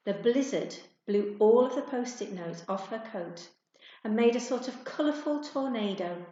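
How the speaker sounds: usual speaking voice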